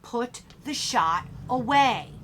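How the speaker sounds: slow, firm command; deliberate coach's warning; stern order. A narrator's reading. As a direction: space out each word as an order.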